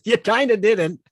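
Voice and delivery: giggling tease; laughing. Real, not acted. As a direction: laugh while teasing your friend. Laughing at co-host's remark.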